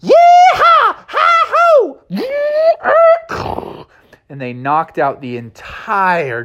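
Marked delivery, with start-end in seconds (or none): 0.0-3.9 very high loud whoops; very high cub whoops; whooping yells. Acted. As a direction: holler with joy. Junior whooping.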